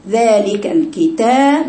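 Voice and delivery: slow recitation with an open تاء in الكتاب; very slow, open-vowelled ذلك الكتاب; open fatha demo. Shown as a demonstration. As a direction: stretch الكتاب, opening the تا fully. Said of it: "مفتوحة التاء".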